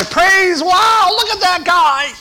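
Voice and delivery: a fan in the stands marvelling; cheering fan. Pretend. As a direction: shout in awe at a great play. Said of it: "We say".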